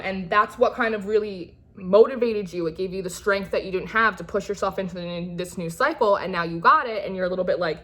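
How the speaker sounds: ordinary talk, nothing marked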